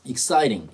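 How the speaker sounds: exciting with T softened to an R-like flap; American flapped T. Shown as a demonstration. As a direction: say "exciting" like "ek-SAI-ring". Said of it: "T가 요즘 미국에서 알 발음하죠". (exciting의 T를 미국식 R처럼 굴려서)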